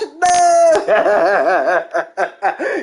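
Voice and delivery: long, staccato laugh; big cackle. Real, not acted. Streamer cracking up at his own parody, cackling. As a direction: crack up at your own joke.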